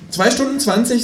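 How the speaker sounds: high cheeky kangaroo. Acted. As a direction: answer like the cocky kangaroo.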